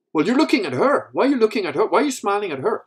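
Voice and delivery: high, loud interrogation; jealous accusation. Acted. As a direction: fire off accusing questions as the wife. Wife accusing husband of looking.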